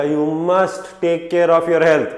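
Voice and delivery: slow, weighty instruction with a long MUST; strong doctor's advice. Acted. Doctor advising a patient on health, firmly. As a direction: stretch "must" as a firm order. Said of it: "strong advice".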